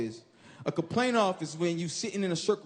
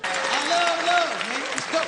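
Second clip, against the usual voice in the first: hyped entrance; 7 semitones higher, 5 dB louder, slower than usual. Acted. Hype entrance chant to crowd.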